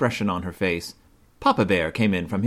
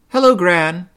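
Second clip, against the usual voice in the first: cheerful phone greeting; 9 semitones higher, 7 dB louder than usual. A narrator's reading. Mama answers phone.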